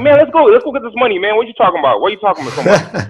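high, loud, pumped to go get money; bursting with cocky energy, breaking into a laugh; hyped-up eagerness. Retold or imitated. Retold eager reply to manager.

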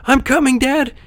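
high, eager puppet yell. A narrator's reading. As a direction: call ahead to a father. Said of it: "he yelled".

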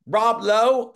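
put-on loud, high Southern-accented query; Carl Killingsworth. Southern man mishears the page's name, imitated loud Southern accent. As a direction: imitate a Southerner's incredulous question. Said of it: "that's how Carl talked".

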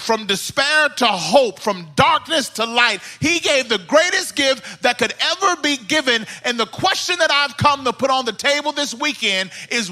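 fervent sermon with punched key words; impassioned preaching. Real, not acted. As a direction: preach it with full, rising conviction. Preaching at full force.

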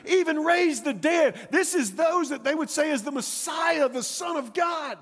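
high-pitched, building announcement; awed, high-pitched telling; excited bystander. Acted. Excited bystander tells beggar the news.

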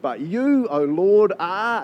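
loud, high, full-throated praise; hearty proclamation. Retold or imitated. Pastor acting out David's hearty cry to the Lord, loud and high. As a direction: belt it out like a triumphant psalm. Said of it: "with a hearty".

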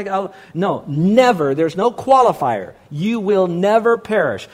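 usual speaking voice

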